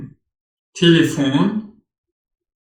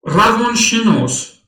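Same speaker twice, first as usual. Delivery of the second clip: normal talking voice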